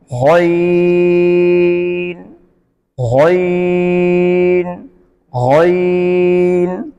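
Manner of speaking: गैन with full throaty start, held long; slow steady recitation of गैन; heavy, long ghayn. Shown as a demonstration. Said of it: "गैन को मोटा किया जाएगा". (गैन को मोटा करके खींचकर)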